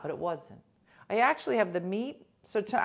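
ordinary delivery

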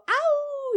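a short, high little howl; just a quick yelp at the moon; small howl. Retold or imitated. The plain howl he did not do. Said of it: "he wouldn't just go".

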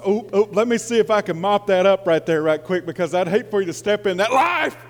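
building, lively preaching voice. Acted. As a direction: preach with playful fervour. Preaching, acted grocery scene.

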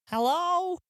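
high, unsure call out; tentative hello. Acted. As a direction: call into the empty hall. Calling out in empty temple.

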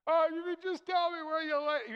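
high, loud frantic pleading; mock frantic Mary. Mary pleading with the gardener, high and frantic. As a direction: blurt it out in a panicky rush. Mary's frantic plea mimicked.

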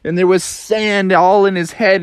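ordinary delivery

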